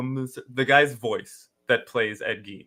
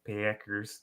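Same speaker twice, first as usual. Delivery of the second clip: Chicago Packers; 5 semitones lower, 14 dB quieter than usual. Shown as a demonstration. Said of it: "that sounded Chicago".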